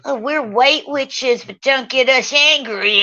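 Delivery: high, slow sneering witch; mocking witch voice. Mocking Catherine Guthrie, a high sneering witch voice. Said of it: "That's what you sound like".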